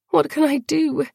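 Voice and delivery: high, helpless plea; anguished. A narrator's reading. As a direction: plead to be of some help.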